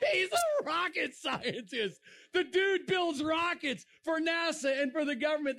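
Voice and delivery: high, exclaimed reveal; incredulous punchline. Real, not acted. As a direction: burst out with the astonishing fact. Delivers the ironic punchline.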